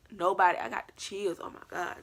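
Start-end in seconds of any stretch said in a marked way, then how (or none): 1.4-2.0 breathless whispered gasp. Real, not acted. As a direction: whisper it in fright. Chills after seeing figure.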